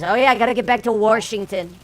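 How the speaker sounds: a mock Trump aside; Trump impression. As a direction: toss it off like Trump heading home. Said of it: "He says".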